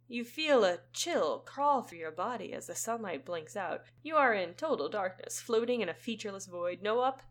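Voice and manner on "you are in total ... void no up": ordinary talk, nothing marked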